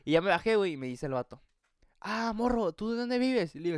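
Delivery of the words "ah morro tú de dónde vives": imitated stranger. Acts out the stranger's questions.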